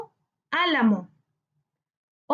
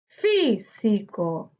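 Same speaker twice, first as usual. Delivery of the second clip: "Físico" very slowly, split into fí-si-co; syllable by syllable; 4 semitones lower, much slower than usual. Shown as a demonstration. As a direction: break "Físico" into three drawn-out syllables. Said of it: "debemos separar por sílabas". ("Físico" separado lentamente por sílabas, fí-si-co)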